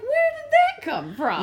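high, slow bewilderment; hammed-up astonished query; incredulous question. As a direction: retell your bafflement in a high, stretched voice. Retells texting friend in disbelief.

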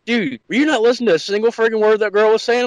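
scolding friend. Retold or imitated.

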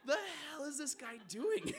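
loud, high, incredulous mock reaction; baffled onlooker. Lone Turkish farmer watching a screaming tourist, loudly baffled. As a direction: voice a stunned bystander's disbelief. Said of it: "shaking his head like".

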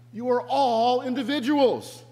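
high, loud, insistent shout; Brian yelling to mob. Acted. Reluctant messiah to the mob following him, yelling. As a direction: call it out high over the crowd. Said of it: "he yells to them".

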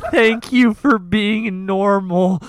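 slow, very loud retold shout; emphatic relief. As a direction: belt it out word by word, desperately grateful. Retelling relieved phone call to Mal.

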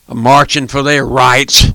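ordinary talk, nothing marked

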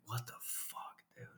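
breathy whispered disbelief under the breath; shocked whisper. Retold or imitated. Hiker whispering to himself about the stranger, shocked whisper. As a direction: hiss it so the stranger can't hear. Startled inner reaction on trail.

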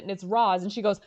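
usual speaking voice

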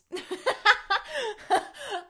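long, high, loud laugh; loud burst of high-pitched laughter; giggling fit. Real, not acted. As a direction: crack up at your own silly phrase. Cracks up at own phrase.

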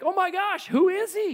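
high, loud eager gasp of a question; eager excitement. Acted. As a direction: leap at the news. Nathanael reacts to news.

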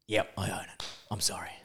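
mock sheepish soft apology; meek apology. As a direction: shrink and mumble the apology. Meek apology after being scolded.

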